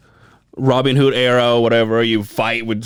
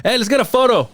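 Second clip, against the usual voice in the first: mock eager voice; 11 semitones higher, faster than usual. Mocking people asking for photos.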